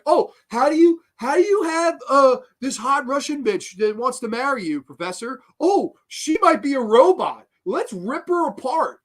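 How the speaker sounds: high, exaggerated dumb amazement; mocking, high-pitched quote; mock excited idiots. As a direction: squeal it like clueless students jumping to conclusions. Mocks the idiot students' reasoning.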